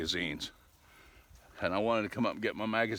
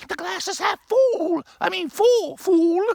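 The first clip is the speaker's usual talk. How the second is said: much higher, much louder, slow cartoonish arguer; silly high cartoon voice; 14 dB louder, slower than usual. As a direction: ham up the know-it-all correcting a friend.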